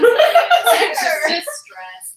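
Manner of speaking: slow, laughing high. Real, not acted. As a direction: laugh the words out. Laughing after the scene.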